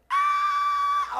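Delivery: long, loud, very high shriek; mock scream held on one note. Man seeing car burst into flames, long high scream. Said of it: "All I hear was scream".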